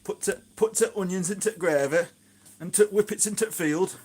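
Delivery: loud, high-pitched mock Yorkshire; exaggerated Yorkshire dialect, belted out; Yorkshire accent. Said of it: "talking like a Yorkshireman".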